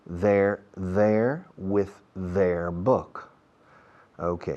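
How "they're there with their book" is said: very slow "They're there with their book", each th drawn out; careful slow drill; slow pronunciation demo. Shown as a demonstration. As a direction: stretch every word for learners. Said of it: "pronunciation demo, very slow".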